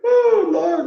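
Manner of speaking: high, loud "Oh, Lord"; startled exclamation. Real, not acted. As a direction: exclaim in shock at a comment. Exclamation at a comment.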